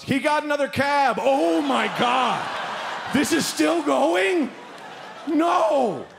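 high, drawn-out disbelieving wail; mock dismay. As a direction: act a busload horrified it continues. Acts passengers' dismay at second cab.

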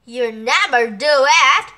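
loud, jeering dismissal; scoffing. Acted. The animals mock the turtle's offer, loudly scoffing.

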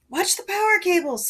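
high, loud cry of alarm; shouted warning. A narrator's reading. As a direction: yell a warning across a crowd. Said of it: "someone shouted".

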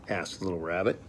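ordinary delivery, nothing marked

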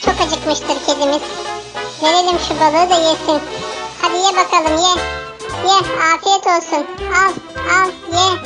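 ordinary talk

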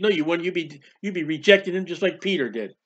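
usual speaking voice

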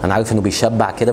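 normal talking voice

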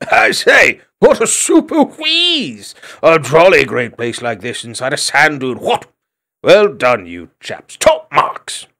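very high, loud toff exclamations; a hare gushing to the young hogs; posh excitable hare. A narrator's reading. Hare admires dwelling.

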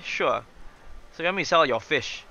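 usual speaking voice

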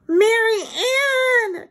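very high, drawn-out cry. A narrator's reading. Susan points at her doll in the water, crying out. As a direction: stretch the name in a high, urgent cry. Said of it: "cried Susan".

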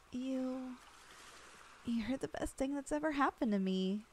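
ordinary talk, nothing marked

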